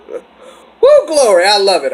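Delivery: high, loud joyful whoop; delighted whoop. Real, not acted. Praising the brothers' takedown, a high, loud joyful whoop. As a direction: cheer it out with glee.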